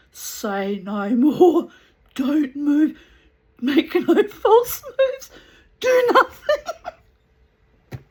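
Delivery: mock panic, stretching each word, laughter creeping in; panicked warning. Retold panicked warning to the children.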